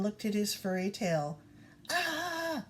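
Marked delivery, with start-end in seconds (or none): 1.9-2.7 cry of alarm. Acted. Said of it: "he cried".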